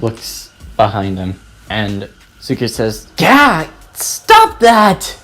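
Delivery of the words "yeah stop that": high, loud yelp of protest; startled yell. Acted. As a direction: yell it out, pitch jumping high. Startled character snaps at teacher.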